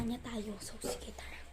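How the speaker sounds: soft unvoiced whisper; whispering while hiding. Real, not acted. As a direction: whisper the plan quietly. (pabulong habang nagtatago)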